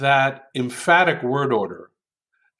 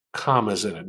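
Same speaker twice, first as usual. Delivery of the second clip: ordinary talk, nothing marked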